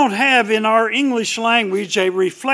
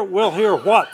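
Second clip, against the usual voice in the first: ordinary talk, nothing marked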